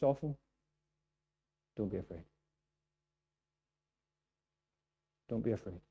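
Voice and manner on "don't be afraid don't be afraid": hushed, gentle repetition; preacher answers his question, hushed and tender; soft reassurance. Shown as a demonstration. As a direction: say it softly, like comfort.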